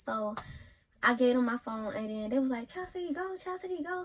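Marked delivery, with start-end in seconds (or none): 2.7-4.0 urging cheer. Retold or imitated. Friends urging her to fight.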